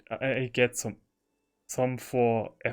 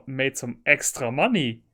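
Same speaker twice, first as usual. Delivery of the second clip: usual speaking voice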